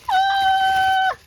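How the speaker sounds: long, very high squeal of delight. Real, not acted. Vlogger thrilled by new magazines, excited squeal.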